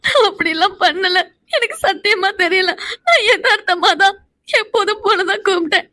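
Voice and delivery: tearful denial; sobbing self-defence; crying. Acted. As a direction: insist you meant no harm, crying. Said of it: "இப்ப நீ எதுக்குடி அழுதுட்டு இருக்க".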